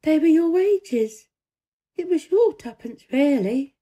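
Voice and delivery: put-on careful, lifted child voice; Mary's voice. A narrator's reading. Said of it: "she said".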